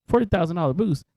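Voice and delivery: can't believe the number; surprised. Real, not acted. Repeats the figure in disbelief.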